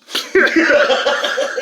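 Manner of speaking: high loud burst of laughter. Real, not acted. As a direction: let out a big laugh. Laughing at memory.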